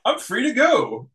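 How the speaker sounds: hint of a relieved Spike stepping out; Spike's voice. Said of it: "Soundwave comes up".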